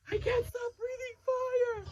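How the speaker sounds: high, frantic panic; panicking dragon dad; panicked. Acted. Father panics, fire won't stop.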